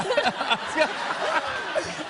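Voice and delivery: big burst of laughter. Real, not acted. Laughs after botching the boomerang.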